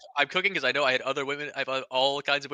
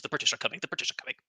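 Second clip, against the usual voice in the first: ordinary talk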